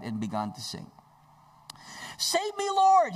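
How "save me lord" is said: high, loud cry for help; shouted plea. Acted. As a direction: scream it as you sink. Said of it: "he shouted".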